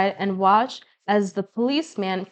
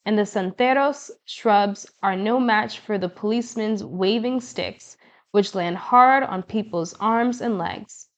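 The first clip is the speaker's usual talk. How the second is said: plain reading voice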